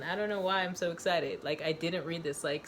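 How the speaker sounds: usual speaking voice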